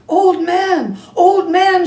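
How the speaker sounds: put-on boy shouting to the old man. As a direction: call out urgently across the yard. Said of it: "shouted Chang".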